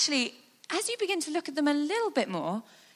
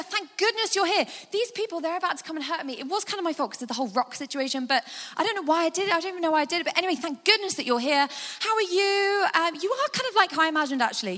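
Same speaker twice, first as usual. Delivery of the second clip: relieved gush; 6 semitones higher, faster than usual. Acted. Rushed relief greeting Jesus.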